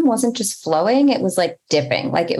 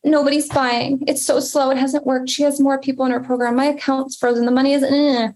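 higher, whiny complaining voice; mock whining; 6 semitones higher than usual. Mocking her own panic that nobody's buying, whiny. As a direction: play up the self-pity as a joke.

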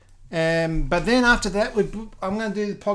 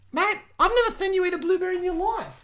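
mock telling off; 11 semitones higher than usual. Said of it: "And I said".

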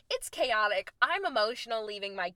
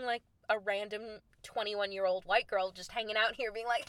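usual speaking voice

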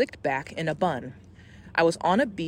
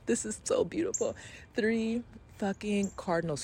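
awed breathless whisper; 4 semitones higher, 6 dB quieter than usual. Real, not acted. Moved by the cardinals.

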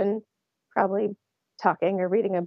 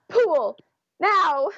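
urgent command; 8 semitones higher, 3 dB louder than usual. Retold or imitated. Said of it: "And I just go".

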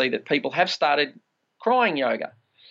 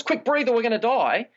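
higher, hammed-up urgent panic; mock panic; 9 semitones higher than usual. The body's reflex voicing its fear of dying, mock panic. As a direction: play the body's alarm, mildly frantic. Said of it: "body's conditioned response".

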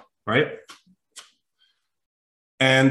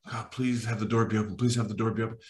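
muttered prayer; 2 semitones lower, 7 dB quieter, faster than usual. Retold or imitated. Praying under his breath.

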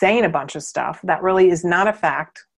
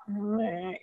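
much softer, flat dismissive meh; bored meh; 12 dB quieter than usual. Retold or imitated. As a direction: shrug it off with a meh. Showing disconnected feeling.

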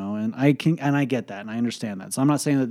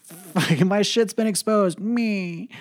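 higher, whiny mock fury, slowed on the first words; mock angry rant; 9 semitones higher, much slower than usual. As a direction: ham up a sulky furious stomp down the street. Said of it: "I went storming down the street like".

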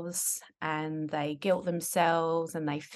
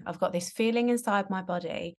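usual speaking voice

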